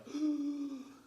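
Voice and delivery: high, loud mimicked gasp; shocked gasp. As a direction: gasp in exaggerated horror. Imitates her reaction to the mess.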